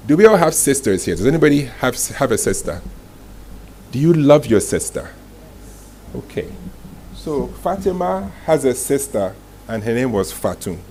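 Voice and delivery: ordinary talk, nothing marked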